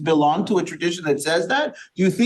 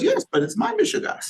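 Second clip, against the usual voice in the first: imitating an elderly grandmother, much higher; grandmother's retort. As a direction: answer back like a stubborn old grandmother. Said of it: "She goes".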